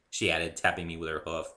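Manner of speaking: ordinary delivery, nothing marked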